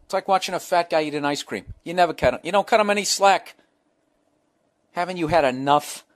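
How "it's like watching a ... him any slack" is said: ordinary talk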